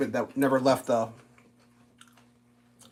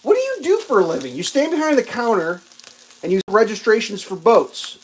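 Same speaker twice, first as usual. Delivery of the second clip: mock outrage; 7 semitones higher, 4 dB louder than usual. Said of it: "I was like".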